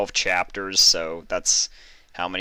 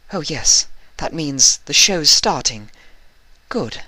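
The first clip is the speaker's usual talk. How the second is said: nervous; 7 semitones higher, 8 dB louder than usual. Acted. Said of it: "she mumbled nervously".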